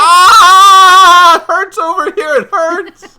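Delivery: high, loud hammed-up yelp of pain; mock pain cry. Pretending to be hurt while co-host says goodbye, hammed-up high yelp. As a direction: squeal as if hurt, clearly playing.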